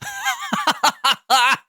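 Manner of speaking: squealing high laugh. Real, not acted. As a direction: burst into a high giggle. Laughing at own impression.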